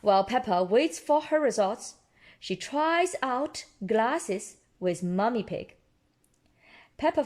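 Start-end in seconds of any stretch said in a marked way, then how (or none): none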